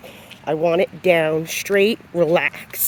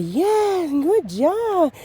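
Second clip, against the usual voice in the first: high-pitched gushing praise; excited praise; 11 semitones higher, much slower than usual. Shown as a demonstration. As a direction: gush at the dog like it's the best thing ever. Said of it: "I don't do a lot of".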